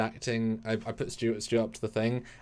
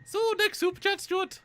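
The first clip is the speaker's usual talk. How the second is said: Scottish puppet voice. Acted. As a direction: greet her like a squeaky Scottish puppet. Keeps puppet voice.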